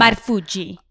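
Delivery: Marfuji with an Italian accent; Italian-accented surname, tapped r. Shown as a demonstration. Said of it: "like, the Italian accent".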